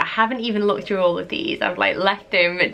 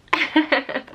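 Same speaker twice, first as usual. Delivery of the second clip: giggle; 7 semitones higher than usual. Real, not acted. Showing childhood dress.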